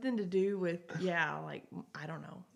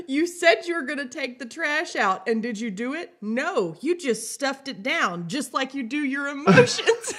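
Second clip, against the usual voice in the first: higher and louder, laughing through the wife's scolding; laughing reenactment; 5 semitones higher, 7 dB louder than usual. Retold or imitated. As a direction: act out the wife's jab, cracking up as you go. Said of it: "That's a reenactment".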